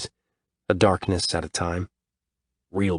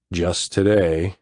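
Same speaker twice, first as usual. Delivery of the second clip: slow lazy drawl; very slow drawled reply; much slower than usual. Acted. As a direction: stretch it out, unbothered. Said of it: "Shane drawls".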